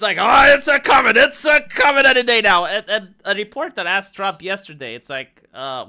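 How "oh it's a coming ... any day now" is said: high, very loud mock excitement; hyped-up mock shout. Host imitating everyone awaiting the list, mock shouting. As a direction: bellow it like a fevered true believer.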